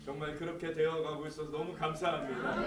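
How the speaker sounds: normal talking voice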